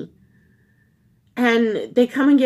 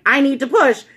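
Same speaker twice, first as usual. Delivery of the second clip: higher, louder insistent outburst; retold desperate yell; 5 semitones higher, 6 dB louder than usual. Labouring mother insisting to the doctor, retold yell. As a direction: re-enact a desperate demand, raised voice. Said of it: "And I screamed bloody murder".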